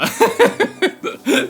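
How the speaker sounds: bursts into a high, breathy laugh; real laugh at own coming-out story. Real, not acted. Laughing at coming out to granddad by shouting at his brother, high laugh.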